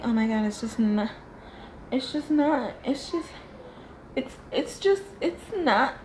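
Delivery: exasperated. Real, not acted. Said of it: "struggling for words about makeup".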